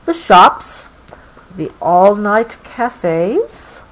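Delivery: gentle, unhurried storybook read; reading to a child. Acted. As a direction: soothe a child toward sleep with the story. Reading the book slowly.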